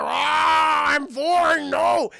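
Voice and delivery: a caught monster yelling back; mock shout.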